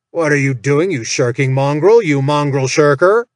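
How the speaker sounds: imitation of Malmore chewing out the orc; imitating Malmore. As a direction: bark it like a bullying orc boss. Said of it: "in his best imitation of Malmore's voice".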